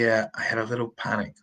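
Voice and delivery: ordinary talk, nothing marked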